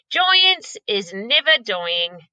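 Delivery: slow, deliberate giant. Acted. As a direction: say it slowly and surely. BFG slow.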